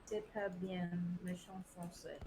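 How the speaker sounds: soft, tentative French; attempted French pronunciation. Shown as a demonstration. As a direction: quietly try it in proper French. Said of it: "my attempt at saying that properly".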